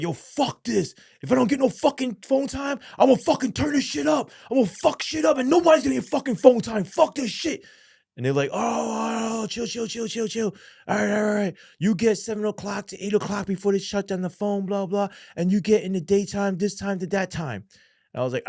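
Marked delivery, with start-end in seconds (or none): 0.0-7.6 high, loud furious rant at the guards; angry threat. Retold or imitated. Inmate yelling at guards over denied phone time, furious shout. 8.5-17.7 high mock guard voice, backing down fast; placating guard. Guard backing down to the angry inmate, high mock placating.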